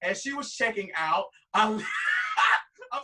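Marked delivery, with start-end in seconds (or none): 1.7-2.9 bursting, breathy laugh; loud laugh. Real, not acted. Queen laughs at his own creepiness, loud burst.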